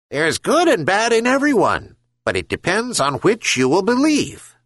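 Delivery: high bird voice; gull voice. Acted. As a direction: speak as the wise gull. Herring gull speaking.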